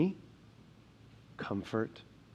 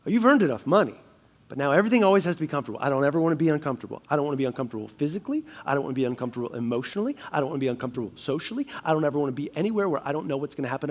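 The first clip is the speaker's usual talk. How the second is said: fast, tumbling run of wants; rushed comfort-seeker; faster than usual. Acted. Pastor voicing a listener's craving for comfort, rapid and anxious. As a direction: rattle the wants off quickly like a nervous list.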